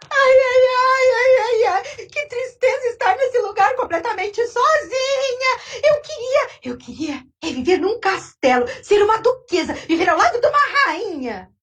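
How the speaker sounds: put-on crying old lady. Old lady wailing in her empty house, high and tearful. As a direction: whimper and wail like a spoiled old lady.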